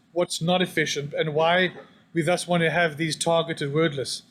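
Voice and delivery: ordinary talk, nothing marked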